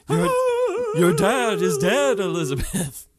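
grandma's ghost tells Elizabeth her dad died, in a slow eerie moan; haunted ghost. Pretend. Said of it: "like this haunted figure".

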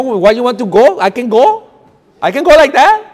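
high, loud comic exasperation; acted incredulous protest. Traveller baffled at being told to go without paper, comically incredulous. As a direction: build to a high-pitched, loud mock protest.

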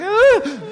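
high, loud mock scream of a happy-scared kid; piercing playful squeal. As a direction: imitate a little girl's excited shriek. Little girl peeking out, happy scared.

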